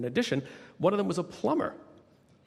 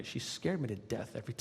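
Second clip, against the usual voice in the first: usual speaking voice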